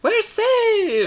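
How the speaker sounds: squealing, gleeful cheer; excited cheer. Acted. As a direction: cheer it out, high and bright. Cheering after solving the problem.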